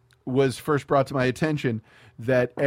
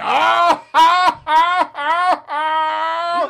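high, loud mock cackle of oh's; De Niro cackle; 9 dB louder, much slower than usual. Laughing at the movie like De Niro in Cape Fear, loud high cackle. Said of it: "Robert De Niro in Cape Fear laughing".

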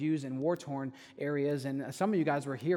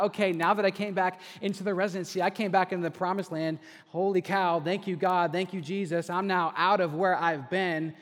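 higher, louder, gushing with relief; relieved excitement; 5 semitones higher, 8 dB louder than usual. Retold or imitated. Pastor recalling his joyful relief at returning, loud and excited. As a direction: celebrate getting out, thanking God. Relieved thought back in residency.

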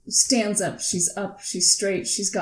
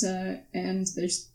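normal talking voice

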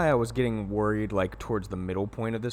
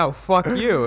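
higher, louder imitated comeback; mock angry retort; 7 semitones higher, 6 dB louder than usual. Retelling his comeback to the game character, mock angry. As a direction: throw the insult back, playfully heated. Acted retort in swearing match.

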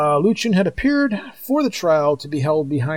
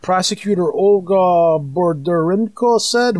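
normal talking voice

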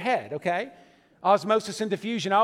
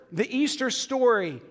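normal talking voice

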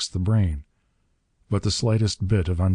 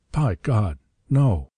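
startled; 3 semitones higher, slower than usual. A narrator's reading. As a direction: blurt it out, startled. Startled exclamation.

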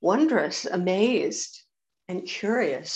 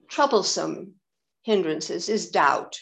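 normal talking voice

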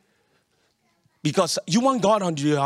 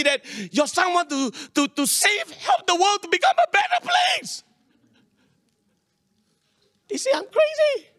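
high excited voice; 10 semitones higher than usual. Retold or imitated. Retelling family reaction, high voice.

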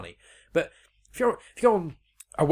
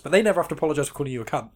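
normal talking voice

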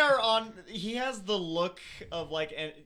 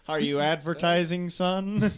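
loud teasing; 8 dB louder than usual. Pretend. Teasing mock parent.

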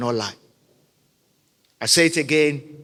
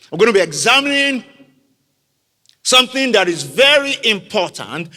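higher and louder, pressing the point; emphatic preaching; 5 semitones higher, 6 dB louder than usual. Acted. Preacher introducing his sermon topic, loud and high. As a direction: launch the message with force.

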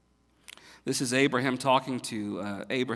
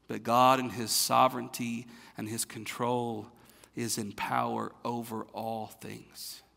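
usual speaking voice

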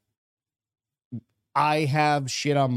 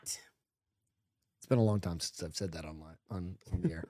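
quiet; 8 semitones lower, 10 dB quieter than usual. Real, not acted. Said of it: "muttered aside".

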